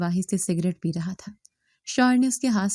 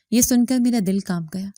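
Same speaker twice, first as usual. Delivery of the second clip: ordinary delivery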